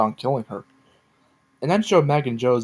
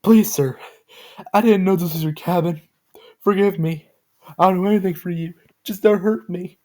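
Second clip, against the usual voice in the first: pleading in tears; 7 semitones higher than usual. A narrator's reading. Joe begging the masked figure to spare her, tearful. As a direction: plead for mercy through tears. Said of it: "Joe, still in tears".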